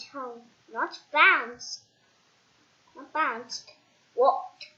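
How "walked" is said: ordinary delivery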